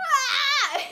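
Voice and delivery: shrieking fan scream with a laugh; excited scream. Retold or imitated. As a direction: squeal like a fan at a concert. Fangirl screaming at a concert.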